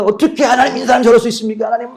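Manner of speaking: high, loud aggrieved plea; indignant complaint. Retold or imitated. Pastor imitating his own aggrieved prayer, high and loud. Retold self-righteous complaint.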